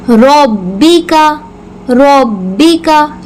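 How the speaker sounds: रब्बिका slowly, ब्ब pressed firm and clear; firm doubled ba. Shown as a demonstration. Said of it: "मजबूती के साथ पढ़ेंगे". (रब्बिका में बा मजबूती से)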